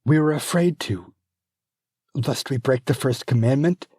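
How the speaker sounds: frightened servant; timid maid. Acted. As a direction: answer with fear. Maid explains fearfully.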